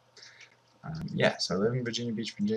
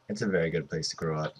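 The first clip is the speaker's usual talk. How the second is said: ordinary talk, nothing marked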